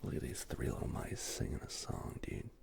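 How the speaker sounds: ordinary talk, nothing marked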